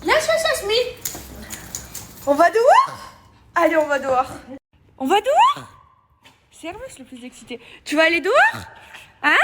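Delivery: high, loud sing-song pet talk. Real, not acted. Owner greeting her excited dogs in baby talk. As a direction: coo at the dogs like toddlers.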